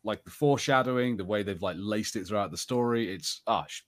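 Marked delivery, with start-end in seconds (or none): none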